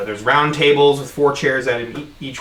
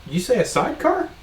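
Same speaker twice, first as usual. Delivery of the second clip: lightly voiced surprised bartender; 9 semitones higher, 4 dB quieter than usual. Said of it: "he stops and looks up at you and goes".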